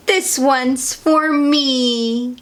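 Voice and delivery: high, drawn-out little truck voice; truck character. A narrator's reading.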